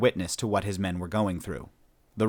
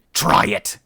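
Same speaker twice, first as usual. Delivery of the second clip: loud snarl, pitched much higher; angry snarl; 10 semitones higher, 8 dB louder than usual. A narrator's reading. Shipmaster answers the human's threat with a loud furious snarl. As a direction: snap back with a furious challenge. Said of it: "Herkurtzel snarled despite himself".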